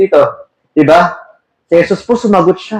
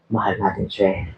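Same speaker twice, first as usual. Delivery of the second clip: tender; 10 semitones lower, 12 dB quieter than usual. Real, not acted. Tender love for Christ.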